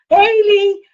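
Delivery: high, loud hello; excited greeting. Real, not acted. Host greeting viewer Lee, excited call. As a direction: call out happily to a friend.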